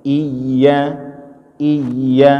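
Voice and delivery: "Iya" with a plain, clear i; wrong clear i. Shown as a demonstration. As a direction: demo the wrong "iya" sound. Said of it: "jangan iya". ("iya" dengan i jelas yang salah)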